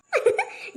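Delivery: short giggle. Acted. Daughter-in-law laughs at teasing.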